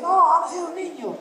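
mock amazement. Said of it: "no dice".

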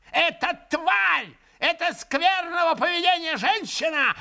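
high shrill mocking old man; ranting old man. A narrator's reading. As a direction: sneer and shriek at the monks. Old man rants at monks.

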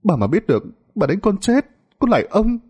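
high, pleading woman's plea. A narrator's reading. A woman begging the old man not to touch her.

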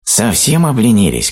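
high, indignant little shout; high-pitched, mildly shouted reproach; scolding ant cry. A narrator's reading. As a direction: berate the lazy as a tiny ant. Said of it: "кричал муравей".

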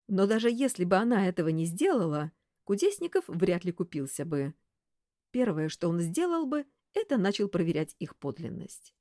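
usual speaking voice

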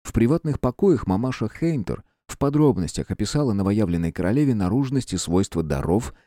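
ordinary delivery

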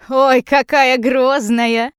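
loud, slow, drawn-out teasing; mock the threat, drawing the words out; mocking amusement. A narrator's reading. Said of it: "смеётся дядя Коля".

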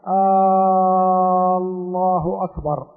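آه stretched long and loud before الله أكبر; questioning drawn-out hamza. Shown as a demonstration. Teacher demonstrates the wrong stretched آه الله أكبر. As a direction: drag out آه so it asks a question. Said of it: "هذا تمطيط يحيل المعنى".

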